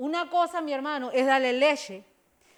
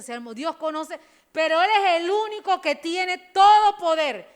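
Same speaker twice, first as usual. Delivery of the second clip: fiery preaching; 3 semitones higher, 11 dB louder than usual. Acted. Preacher exhorting the congregation, loud and fired up. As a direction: preach it out to the whole church.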